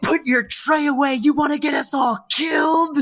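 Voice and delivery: hammed-up panicked stewardess; mock frantic scold. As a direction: yell at Fran about the tray. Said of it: "she runs up to Fran and she goes".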